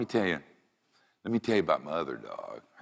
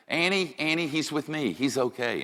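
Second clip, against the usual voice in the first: high-pitched imitation of a reassuring wife; put-on high voice, calming a pet; wife soothing the dog; 8 semitones higher than usual. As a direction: coo to a little dog in a raised voice.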